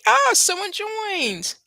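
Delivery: high, loud, drawn-out exclamation; delighted surprise. Real, not acted. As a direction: greet an unexpected arrival with real surprise. Live viewer suddenly joins the stream.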